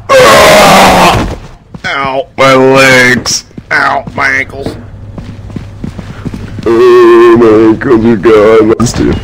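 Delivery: ordinary talk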